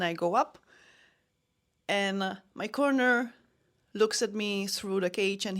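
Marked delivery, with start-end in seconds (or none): none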